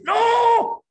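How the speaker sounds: long, very high, loud "No"; desperate scream. Acted. Man screaming as death takes his hand, desperate yell. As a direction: scream it out in terror, drawn out.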